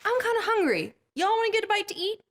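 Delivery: high, fast, easygoing Jesus voice; casual Jesus. Acted. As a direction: toss off a breezy lunch suggestion. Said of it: "Jesus turns and says".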